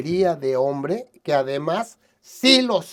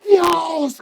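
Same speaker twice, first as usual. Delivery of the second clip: grita agudo y fuerte; grito; 11 semitones higher, 8 dB louder than usual. Retold or imitated. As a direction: clama a Dios a todo pulmón. (grita Dios ante la iglesia)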